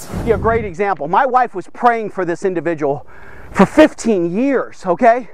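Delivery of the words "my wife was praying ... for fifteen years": usual speaking voice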